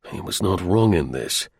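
ordinary delivery

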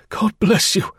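overjoyed cry of thanks. A narrator's reading. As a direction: burst out thanking him, pitched high. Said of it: "he cried".

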